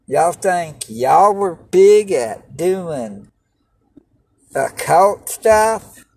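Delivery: loud, slow taunting scoff; mocking scoff. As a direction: scoff at them, slowly. Taunting former occult dabblers.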